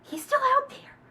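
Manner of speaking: high, loud alarmed realization; shocked realization. Real, not acted. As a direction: it just hit you. Sudden realization about father.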